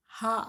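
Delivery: हा said as a short breathy ending sound after आ; हा: just the aspirated ending of वानराः; visarga sound alone. Shown as a demonstration. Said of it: "तो मैंने क्या बोला". (विसर्ग की ध्वनि हा अकेले)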